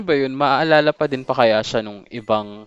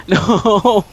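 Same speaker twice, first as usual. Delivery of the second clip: sudden loud cry of no; panicked shout; 8 semitones higher, 8 dB louder than usual. Real, not acted. Streamer dodging a boss attack, panicked shout. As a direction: yelp it out as the hit lands.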